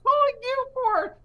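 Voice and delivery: very high, put-on sweet caller voice; a daughter's very high pretend phone voice; fake kid voice. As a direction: chirp it up high like a kid pranking a phone call. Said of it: "I'm doing the fake voice she's doing".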